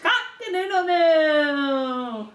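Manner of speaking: high, long drawn-out crow; rooster crow. Acted. Parent showing what the rooster says, long high crowing. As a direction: crow it out, stretching the last note. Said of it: "The rooster says".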